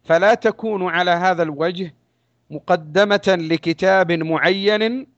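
ordinary talk

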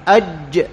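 "أج" with the tongue middle sealed on the palate; closed jeem. Shown as a demonstration. Teacher demonstrates closure in jeem, "أج" fully stopped. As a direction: stop the sound dead on the j of "أج". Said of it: "يحصل انغلاق في الجيم".

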